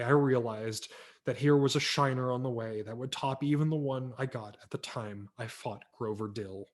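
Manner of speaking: ordinary delivery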